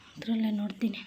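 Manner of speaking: hushed aside, barely voiced; whisper. Real, not acted. Mother checking the time near her sleepy baby, whispering. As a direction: drop to a whisper so as not to disturb.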